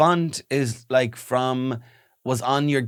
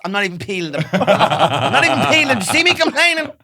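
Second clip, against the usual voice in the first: higher, louder, fast nagging wife; mock furious wife; 6 semitones higher, 6 dB louder than usual. Playing a wife scolding her husband over spaghetti. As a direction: rant like a fed-up Irish mammy. Pretend marital row.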